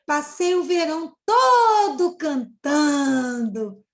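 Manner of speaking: slow, loud, tearful-sounding lament; lamenting exclamation. Acted. Teacher voicing the cicada's regretful exclamation, slow and loud. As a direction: wail it as a regretful confession. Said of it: "Olha a exclamação".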